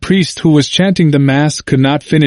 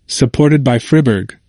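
plain reading voice

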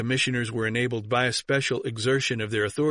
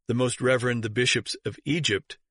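usual speaking voice

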